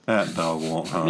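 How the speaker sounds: low, slow Southern drawl; slow country drawl. Pretend. A host tossing out a Southern saying in a low drawl. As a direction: lean back and drawl it like a farmer. Doing bad country accents.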